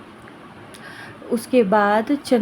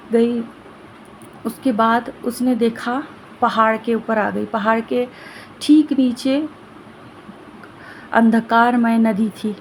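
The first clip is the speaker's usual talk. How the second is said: ordinary delivery, nothing marked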